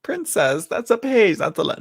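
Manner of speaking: playing a puzzled viewer doubting the card; confused objection. Viewer doubting the card reading, mock baffled. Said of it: "some people would be like".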